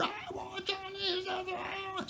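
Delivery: shrieky falsetto imitation of a woman's meltdown; mock crying scream. Thwarted buyer has a meltdown at the seller, mock screaming falsetto. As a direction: wail it very high without real volume. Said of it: "screaming at me".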